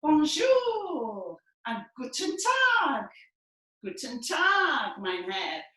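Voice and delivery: loud, slow calls of bonjour and guten Tag; foreign greetings. Shown as a demonstration. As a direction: call out to the Frenchman. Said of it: "Let's say bonjour".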